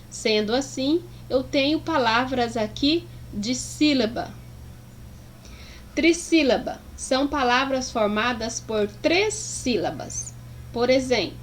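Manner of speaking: usual speaking voice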